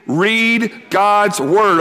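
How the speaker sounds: drawn-out call and response; slow group repeat. Shown as a demonstration. Preacher leading campers to repeat slowly, drawn out. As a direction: say it slowly with the room. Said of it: "Can you say that with me?".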